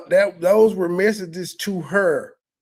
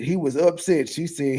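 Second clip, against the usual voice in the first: ordinary talk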